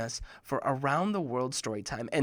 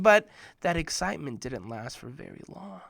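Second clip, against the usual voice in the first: ordinary delivery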